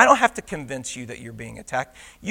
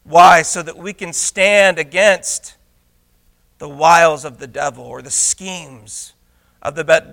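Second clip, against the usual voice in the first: normal talking voice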